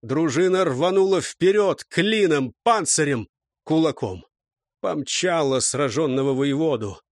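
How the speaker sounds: plain reading voice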